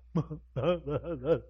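short real laugh. Real, not acted. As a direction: laugh briefly at your own story. Laughs after voicing own plea.

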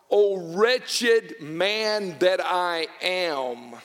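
slow, drawn-out anguished cry. Retold or imitated. Wounded soldier on the battlefield cries out slowly. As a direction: stretch each word like a wounded soldier. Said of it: "And he cries out".

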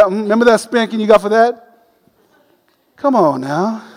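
usual speaking voice

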